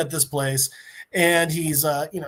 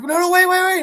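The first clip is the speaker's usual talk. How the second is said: high, loud frantic begging; panicked pleading; 12 semitones higher, 4 dB louder than usual. Retold or imitated. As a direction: beg him to stop, scared. Terrorists panicking as Peacemaker enters.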